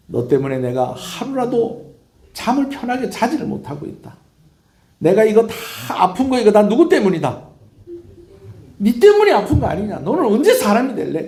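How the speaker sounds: high, loud scolding that builds; nagging mother. Acted. Mother to her unfilial son home for the holiday, nagging. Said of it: "어머니가 잔소리가 끝이 없습니다".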